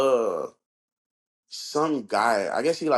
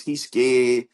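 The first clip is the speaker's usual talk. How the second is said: usual speaking voice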